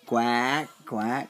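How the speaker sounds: duck quacks. Shown as a demonstration. Parent showing a toddler the duck sound, low quacks. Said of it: "What's the duck say?".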